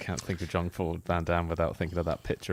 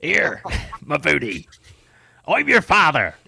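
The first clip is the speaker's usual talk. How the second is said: loud Cornish Darth Vader, drawn out; higher, hammed-up Cornish accent; thick Cornish accent; 7 semitones higher, 7 dB louder, slower than usual. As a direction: boom it out in broad Cornish. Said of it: "he's such a thick Cornish accent".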